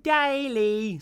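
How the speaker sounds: put-on "Daily" squealed high and loud, like a small child; kid's pronunciation. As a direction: make "Daily" a high baby-like scream. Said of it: "the way he pronounced daily".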